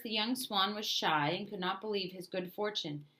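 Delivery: ordinary delivery, nothing marked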